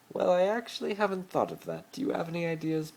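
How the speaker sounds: a hint of a posh rich boy pleading; Malfoy voice. Malfoy pleading with Harry to play his boyfriend, lightly voiced.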